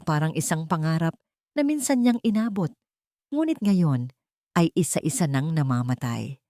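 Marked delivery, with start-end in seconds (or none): none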